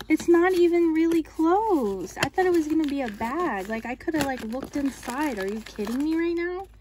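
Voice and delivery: can't believe she could have looked all along; disbelieving outburst. Real, not acted. To viewers, unboxing a bag she could see through, loud disbelief.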